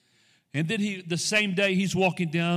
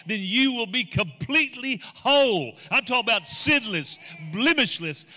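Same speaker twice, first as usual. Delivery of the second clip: higher, building to a loud peak; preaching at rising force; 5 semitones higher, 5 dB louder than usual. Acted. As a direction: preach with mounting fervour, stretching key words.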